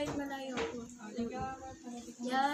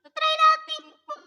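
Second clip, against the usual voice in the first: pitched up high through the mixer; pitch bend voice effect; 10 semitones higher, 7 dB louder than usual. Shown as a demonstration. Vlogger showing viewers the pitch bend effect, high pitched. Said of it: "ganito yung boses ko".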